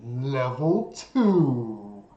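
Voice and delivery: low, slow announcer from nowhere; disembodied voice. Acted. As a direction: drag it out like a ghostly game announcer. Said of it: "you hear a disembodied voice".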